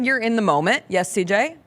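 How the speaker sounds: ordinary delivery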